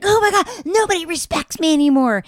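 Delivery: high, wounded mock whine; whiny mock Cody. Friend imitating Cody storming off, mock whine. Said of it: "He's like".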